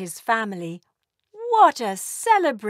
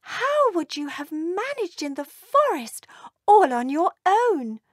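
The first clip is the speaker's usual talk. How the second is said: higher, scolding mother's question; mother dragon scolding the runaway elf, higher pitched; 9 semitones higher than usual. Acted. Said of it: "she said".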